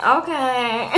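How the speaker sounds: a light laugh inside "Okay"; mock amused reply, breaking into a laugh; imitated laughing reply. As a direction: say it through a small chuckle. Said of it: "he starts to laughing".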